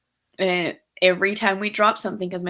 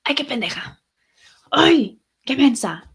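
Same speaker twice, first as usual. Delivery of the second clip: imitating mom's higher-pitched exasperation; mock-scold yourself for a clumsy slip; raised-pitch outburst at yourself; exasperated self-scolding; 6 semitones higher, 5 dB louder than usual. Imitates mom scolding herself after dropping things.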